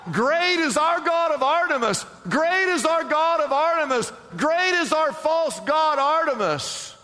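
high-pitched mock protest chant; imitated crowd shout. Preacher mimics those upset at the gospel, mock shouting. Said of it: "they will shout".

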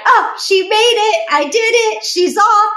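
breezy sing-song victory cry; mock triumphant mom. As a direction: ham up the proud relieved mom. Imagined proud mom sending daughter off.

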